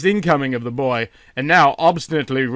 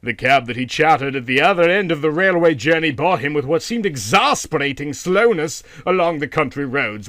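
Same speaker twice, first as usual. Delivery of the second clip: ordinary delivery, nothing marked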